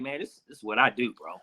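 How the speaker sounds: low, soft, unbothered quote; understated, quiet star-player impression; imitating an unfazed player. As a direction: play the quarterback unfazed, voice dropped. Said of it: "Tom is like".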